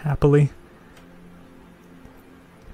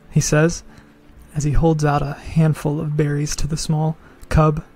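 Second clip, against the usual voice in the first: normal talking voice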